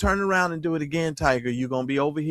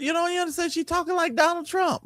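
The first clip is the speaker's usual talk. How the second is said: exasperated; 13 semitones higher, faster than usual. Real, not acted. Mocking the cohost's reading.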